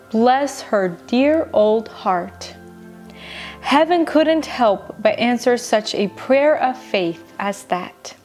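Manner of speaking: usual speaking voice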